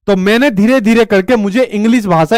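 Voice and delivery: ordinary talk, nothing marked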